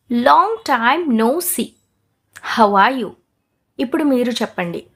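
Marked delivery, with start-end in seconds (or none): 0.1-3.1 very slow, drawn-out words; slow shadowing model. Shown as a demonstration. Teacher repeats it slowly for shadowing. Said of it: "నేను ఎలాగైతే చెప్తానో మీరు అలా అని చెప్పాలి".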